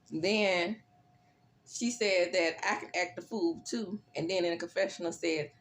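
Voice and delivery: usual speaking voice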